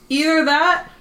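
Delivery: high sung ringtone noise; a cappella ringtone. Pretend. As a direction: sing a ringtone tune with laughs.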